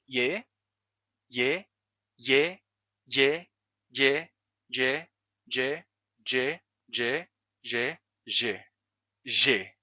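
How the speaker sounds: Ye repeated, the glide hardening gradually into the G sound; slowly morph Ye into the G sound; Ye shifting to G. Shown as a demonstration. Teacher demonstrating the centuries of sound change, Ye sliding into G. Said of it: "a pronúncia vai mudar gradualmente".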